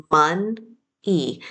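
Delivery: mon-e split in two, MUN, then a separate EE; syllable by syllable. Shown as a demonstration. Teacher splitting money for spelling, "mon-e" syllable by syllable. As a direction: break "mon-e" with a gap before the ee. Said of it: "Let's spell each syllable".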